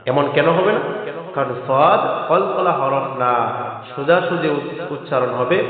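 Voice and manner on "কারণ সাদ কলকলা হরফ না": ordinary talk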